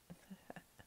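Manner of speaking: very soft, breath-only little laugh; whispered giggle. Acted. Vampire enjoys playing with her captive, whispered laugh. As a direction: laugh almost silently, toying with prey.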